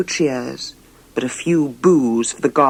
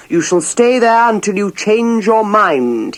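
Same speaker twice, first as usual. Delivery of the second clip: stern king; 4 semitones higher, 4 dB louder than usual. Acted. Angry king locks princess away.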